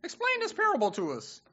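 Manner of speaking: high-pitched disciple pleading; Peter asking. Retold or imitated. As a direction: voice Peter begging for an answer. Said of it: "that's not what Peter's talking about".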